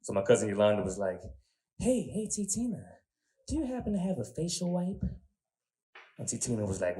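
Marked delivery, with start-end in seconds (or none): 1.8-5.0 put-on high, soft sweet girl's voice; a higher, softer sweet young woman. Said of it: "regular old sweet girl's voice".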